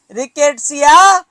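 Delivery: usual speaking voice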